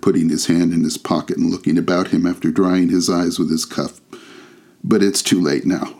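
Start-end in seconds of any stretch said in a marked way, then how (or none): none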